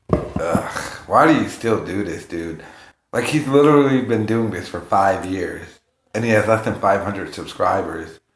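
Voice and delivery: ordinary delivery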